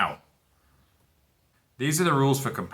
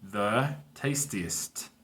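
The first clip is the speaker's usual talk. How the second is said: slow model word; 4 dB quieter, much slower than usual. Shown as a demonstration. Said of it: "El más sabroso".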